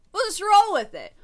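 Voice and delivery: a loud, high shrug of a line; loud, high resignation. Real, not acted. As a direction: throw up your hands and shout it. Repeated in raised voice.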